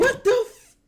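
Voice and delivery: high shriek of disbelief; shocked shriek. Real, not acted. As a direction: scream it out, half laughing. Reacting to clip, shrieks.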